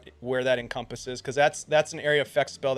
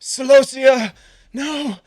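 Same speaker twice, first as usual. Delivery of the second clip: high, loud cry of alarm; desperate shout; 11 semitones higher, 12 dB louder, slower than usual. Acted. Game master voices a character crying out to Celosia, shouting. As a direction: call out at full voice, high and pleading. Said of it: "he kinda stands up and he shouts".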